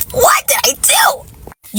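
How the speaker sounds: breathy mocking whisper, slow. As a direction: whisper it like a fake-innocent boyfriend. Mocking his texts.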